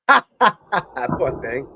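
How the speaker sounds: amused pity through laughter; laughing. Real, not acted. Coach watching a girl hit herself, laughing. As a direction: laugh helplessly, then pity her. Laughing at clip of girl's mishap.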